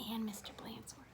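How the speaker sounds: put-on hushed aside to the kids; whispered aside. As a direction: drop to a whisper for the children. Storyteller aside to listening children.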